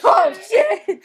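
loud, laughing oh shit; surprised. Real, not acted. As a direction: blurt it out laughing. Surprise reveal Kitty Pryde.

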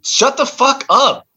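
high, slow, exasperated yell; fed-up yell; angry outburst. Acted. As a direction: punch each word to silence them. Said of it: "I'm getting really angry".

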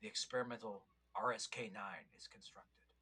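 hushed epic narrator voice; old sci-fi announcer. Pretend. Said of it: "a fun voice".

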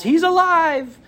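high elated cry; joyful yell. A narrator's reading. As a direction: shout it like the heroine seeing him live. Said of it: "she yells".

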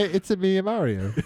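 Mario impression. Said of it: "Now say".

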